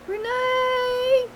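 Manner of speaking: high, long drawn-out holler of the name; sister's holler. Retold or imitated. Sister calling from the porch, long high holler. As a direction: call the name across the yard. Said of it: "she'd go".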